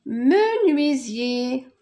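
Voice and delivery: menuisier slow and clear, each syllable apart; slow model word. Shown as a demonstration. Teacher modelling menuisier slowly after its syllables. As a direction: draw out menuisier for the class. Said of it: "Nuit, me".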